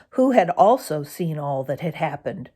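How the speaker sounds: ordinary delivery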